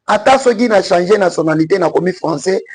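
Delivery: normal talking voice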